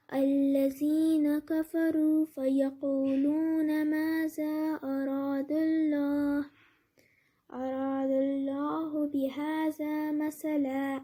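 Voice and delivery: slow, drawn-out measured recitation; Quran recitation. Shown as a demonstration. Teacher reciting a verse to students as an example, slow recitation. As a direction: recite slowly with long held vowels. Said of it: "جیسے".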